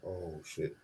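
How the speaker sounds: low, very soft mutter; dread. Retold or imitated. Boy sent to fetch belt, low hushed dread. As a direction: say it under the breath, sinking.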